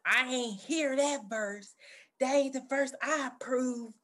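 a hint of [name]'s drawl; imitating [name]. Quoting [name]'s [name] verse in a light [name] impression. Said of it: "When [name] was like".

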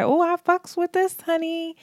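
high-pitched imagined fan; sassy approval. Acted. Voices an imagined happy viewer.

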